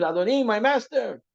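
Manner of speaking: loud, high mock yell for help; retold scream. Servant stuck in the well calls his master, mock scream. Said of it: "So he screamed".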